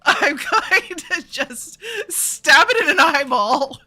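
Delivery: excited. Real, not acted. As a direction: relish every stab. Excited describing kill blow.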